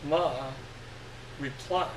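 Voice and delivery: usual speaking voice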